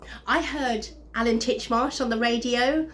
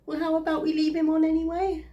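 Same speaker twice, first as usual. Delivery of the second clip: higher-pitched, lightly pouting ex; mock pout; 6 semitones higher than usual. Woman retells ex pleading to leave recording on, mock pouting. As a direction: imitate a sulky lover's pout. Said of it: "He pouted".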